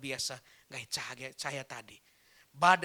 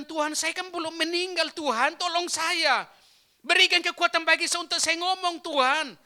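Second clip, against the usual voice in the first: higher, louder desperate plea to God; dying young man pleading with God, higher and louder; pleading prayer; 9 semitones higher, 8 dB louder than usual. Retold or imitated. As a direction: beg God for strength to speak.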